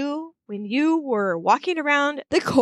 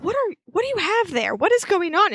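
higher-pitched mock bewilderment; confused; 6 semitones higher than usual. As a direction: act out a puzzled guardian's questions. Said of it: "she's super confused".

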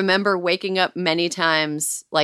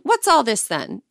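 put-on higher-pitched cheeky British accent; mock British accent; 5 semitones higher than usual. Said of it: "in honor of our U K cast".

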